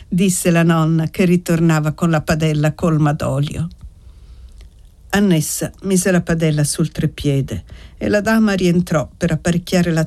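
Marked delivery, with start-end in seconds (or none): none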